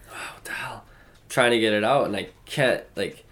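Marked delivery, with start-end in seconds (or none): 0.1-0.8 breathy whisper, half to himself; whispered frustration. Retold or imitated. Man recalling a forgotten French word, exasperated whisper. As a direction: whisper it like you've lost the word.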